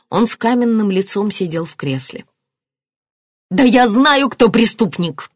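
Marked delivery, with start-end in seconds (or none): 3.5-5.3 loud, raised, insistent outburst; heated declaration. A narrator's reading. Marianna naming the culprit to police, heated. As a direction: declare it angrily. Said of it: "заявила Марианна".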